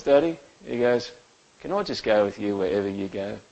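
soft, quiet little boy asking, a hint of a child; just a hush of a small child looking up; lightly voiced little son. Small son asking his dad to come along, quietly childlike. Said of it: "and he goes".

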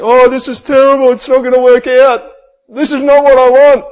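the preacher imitating a stressed-out believer, anxious whine; mock anxious panic. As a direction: act out a panicking worrier. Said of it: "you get anxious and you get stressed and you just think".

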